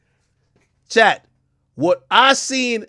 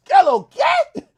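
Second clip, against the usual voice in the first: incredulous; 5 dB louder, slower than usual. Real, not acted. Shocked streamer calling to chat.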